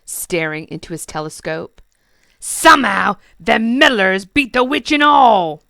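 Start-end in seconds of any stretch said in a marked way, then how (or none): 2.4-5.6 goblin king voice. Acted.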